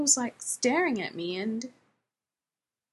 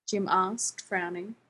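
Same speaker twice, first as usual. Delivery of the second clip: ordinary delivery, nothing marked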